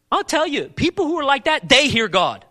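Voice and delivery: loud, high, urgent preacher's call; forceful preaching. Acted. Preacher pressing the congregation on seeking wisdom, forceful. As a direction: drive the question home from the pulpit.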